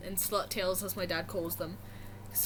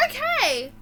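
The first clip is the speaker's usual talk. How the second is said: high chirpy missionary; 10 semitones higher, 4 dB louder than usual. Retold or imitated. Missionary voiced.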